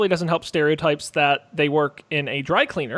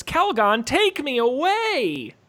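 dreamy slogan, much higher and slowed right down; imitating the ad slogan; 8 semitones higher, slower than usual. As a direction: croon it like the old bath ad, stretching every word. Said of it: "their famous".

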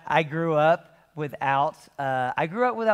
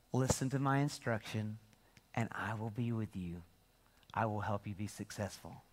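gentle God voice; 5 semitones lower, 8 dB quieter than usual. Retold or imitated. God reassuring the fearful Joshua, softly and gently.

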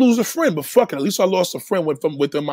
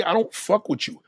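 ordinary delivery

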